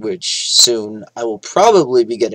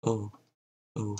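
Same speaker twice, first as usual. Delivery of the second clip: ordinary talk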